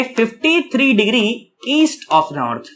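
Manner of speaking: very slow, measured phrasing; slow and deliberate. Shown as a demonstration. Teacher showing students the other name for the direction, slowly. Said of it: "मैं ये भी कह सकता हूं".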